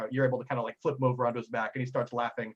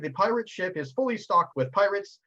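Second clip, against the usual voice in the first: ordinary talk, nothing marked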